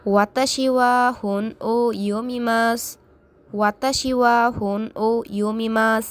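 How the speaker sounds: teacher modelling Japanese, final su dropped; model repetition. Shown as a demonstration. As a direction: say it clearly for learners to repeat. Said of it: "এটা বারে বারে উচ্চারণ করুন".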